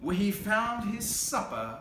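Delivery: lively read-aloud storytelling; story narration. A narrator's reading. Reading a picture book aloud to kids, lively narration. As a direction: read it to a child, drawing it out.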